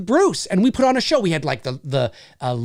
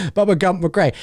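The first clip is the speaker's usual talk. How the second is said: ordinary talk, nothing marked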